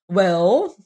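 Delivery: Well said with a rising, questioning tune; rising inflection. A narrator's reading. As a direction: let Well climb up at the end like a question. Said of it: "said young T A with a rising inflection".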